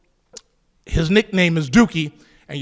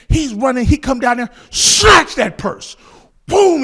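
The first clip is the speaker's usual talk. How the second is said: excited drawn-out telling; 6 semitones higher, 7 dB louder than usual. Retold or imitated. Climax of the purse snatch.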